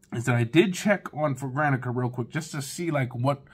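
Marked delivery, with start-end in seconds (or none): none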